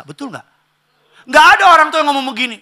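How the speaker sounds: ordinary talk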